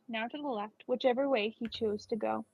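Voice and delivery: usual speaking voice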